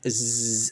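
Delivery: a buzzing zzz like a honeybee; bee buzz. Shown as a demonstration. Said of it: "a bee makes".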